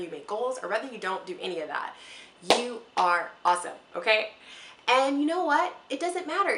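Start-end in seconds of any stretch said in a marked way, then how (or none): 2.5-6.6 excited emphasis. Real, not acted. Emphatic pep to viewers.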